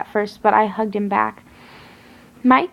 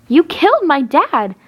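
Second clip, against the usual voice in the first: loud, higher accusing yell; 6 semitones higher, 5 dB louder than usual. A narrator's reading. Girl screaming at her father's killer, loud. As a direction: shout it at him in fury. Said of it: "I yelled".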